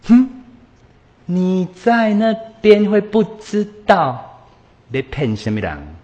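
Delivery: scoffing disbelief. Retold or imitated. Scoffing reply to "what happened.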